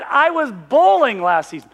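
ordinary delivery

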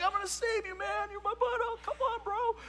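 eager buddy. Retold or imitated. Preacher voicing a friend eager to rescue, high. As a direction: play the friend promising to save his bud.

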